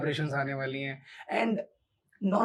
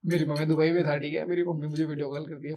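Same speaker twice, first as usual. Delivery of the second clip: ordinary delivery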